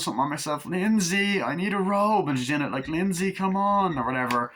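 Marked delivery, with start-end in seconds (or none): 0.7-4.0 higher whiny call for Lindsay; teen girl calling the kid, high and whiny. Retold or imitated. Voicing Annie calling.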